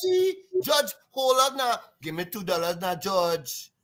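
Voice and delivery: a light Trinidadian lilt begging two dollars; casual Trinidad accent, asking for money. Retold or imitated. Still showing Trinidad talk, a light Trinidad accent. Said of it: "You know how Trinidad talk?".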